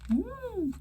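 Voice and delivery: high breathy woo through puffed cheeks. Shown as a demonstration. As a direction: blow air out with cheeks puffed. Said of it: "Looks and sounds like that".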